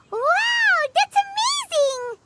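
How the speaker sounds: high, bright burst of delight; excited. Acted. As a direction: gush over the good news. Paimon thrilled at health boost.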